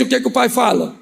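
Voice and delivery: normal talking voice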